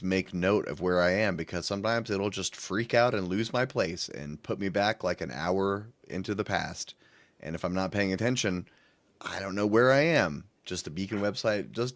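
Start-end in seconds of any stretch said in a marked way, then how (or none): none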